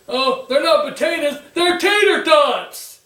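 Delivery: hammed-up Roman Reigns mocking Sheamus. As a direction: drag out tater tots with a sneer. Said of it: "That's Roman's reply".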